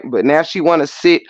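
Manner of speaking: usual speaking voice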